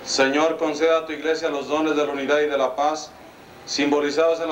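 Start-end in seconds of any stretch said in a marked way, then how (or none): none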